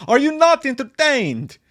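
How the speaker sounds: mock Gladiator arena bellow; Gladiator quote. Host imitates Russell Crowe's Gladiator line, hammed-up. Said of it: "Gladiator Crowe".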